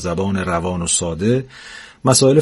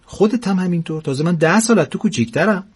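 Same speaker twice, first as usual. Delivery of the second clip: put-on higher, needling old woman's voice; bickering old wife; 8 semitones higher than usual. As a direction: needle him like a teasing wife.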